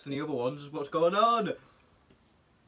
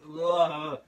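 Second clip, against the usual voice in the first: loud grimacing ugh; sour disgusted groan; 6 dB louder than usual. Real, not acted. Tasting sour lemon candy.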